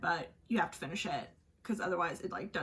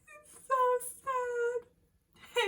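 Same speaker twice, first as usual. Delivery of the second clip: high, drawn-out wailing sob; mock sobbing; 4 dB louder, much slower than usual. Telling her partner why she cries, high wailing mock sob. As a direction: stretch it out through fake tears.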